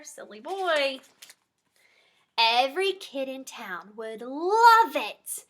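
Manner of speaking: usual speaking voice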